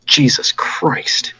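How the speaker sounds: whispered oath, drawn out; awed whisper. Acted. Visitor sees a dragon head mounted above the hearth, slow whisper. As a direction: whisper it slowly under your breath.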